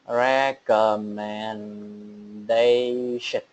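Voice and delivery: recommendation stretched very slowly, syllable by syllable; very slow model. Shown as a demonstration. Teacher modelling recommendation very slowly, syllable by syllable. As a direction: drag out recommendation for the class. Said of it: "Sẽ đọc là".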